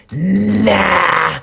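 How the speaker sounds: put-on loud, drawn-out drunken egging on; drunk side. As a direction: holler it like the drunk devil on your shoulder. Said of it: "That's the drunk side of me talking".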